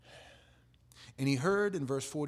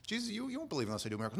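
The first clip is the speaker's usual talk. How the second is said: rushed; 8 semitones higher, much faster than usual. Acted. Imitating Jesus rapidly.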